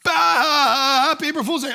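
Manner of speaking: loud, high imitation guffaw; imitated laughter running into a gleeful cry; mock laughing. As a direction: play kids cackling over their prank. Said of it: "started to laugh and said".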